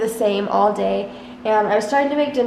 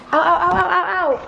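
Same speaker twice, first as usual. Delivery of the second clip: pained yelps; 6 semitones higher than usual. Real, not acted. Dog bites her, she yelps.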